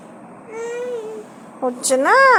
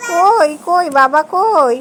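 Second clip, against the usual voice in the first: usual speaking voice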